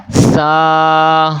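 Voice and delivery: زا held long after a sharp buzzing z; drawn-out زا, z sharply sibilant; whistled z. Shown as a demonstration. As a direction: recite زا on a steady note with a whistling z. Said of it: "سیٹی کے ساتھ پڑھنا ہے".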